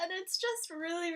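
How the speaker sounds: usual speaking voice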